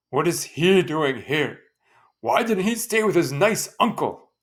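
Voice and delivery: loud, high sarcastic complaint; scornful father; grumbling father. Acted. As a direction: sneer about the uncle. Father scolding absent son.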